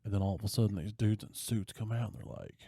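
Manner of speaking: ordinary delivery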